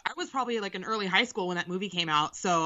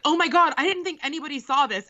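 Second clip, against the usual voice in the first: excited gush; 7 semitones higher, 4 dB louder than usual. Retold or imitated. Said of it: "and she was like".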